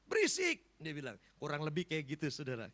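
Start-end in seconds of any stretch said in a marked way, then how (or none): 0.0-0.6 loud, high acted scolding; annoyed shouts, pitched up; scolding crowd. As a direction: tell off someone making a racket. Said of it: "Artinya gini".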